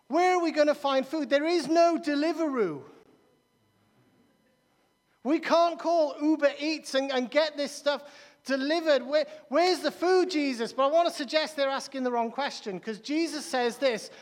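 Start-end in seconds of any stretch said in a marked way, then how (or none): 0.1-10.7 lightly acted complaint, raised pitch; mildly hammed-up hungry disciples pleading. Disciples asking Jesus where to find food, lightly acted complaint. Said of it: "the disciples go, they're hungry".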